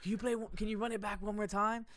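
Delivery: high, loud excited begging; eager, like little kids. Retold or imitated. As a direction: imitate a client pleading like an eager kid. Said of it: "they're like little kids".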